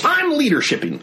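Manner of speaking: mock the eager kid grabbing control; hammed-up voice of the kid in charge; bossy kid taking charge. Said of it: "And so I was like".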